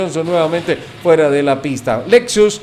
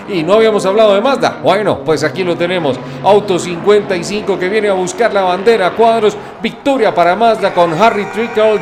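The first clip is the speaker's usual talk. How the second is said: motorsport commentator; 3 semitones higher than usual. Acted. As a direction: call the checkered flag with rising excitement. Finish-line race call.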